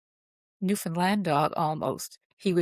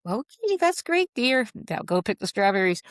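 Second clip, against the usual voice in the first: dismissive parent; 3 semitones higher than usual. Retold or imitated. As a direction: imitate a parent brushing off a child. Voices a parent dismissing a child.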